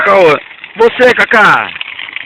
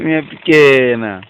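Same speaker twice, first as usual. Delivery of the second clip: tender cooing; 6 semitones lower, slower than usual. Real, not acted. Mother cooing at baby.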